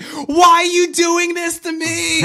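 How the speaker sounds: shrieked very high and slow; hammed-up high, loud, stretched-out scream of despair; mock shriek. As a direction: wail it at the top of her voice, drawn out. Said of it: "she fucking loses her mind again".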